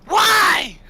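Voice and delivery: very high, loud squeaky wail; upset little creature. Acted. Gitmo upset about mom.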